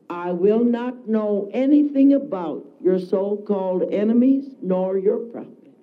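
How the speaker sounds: ordinary delivery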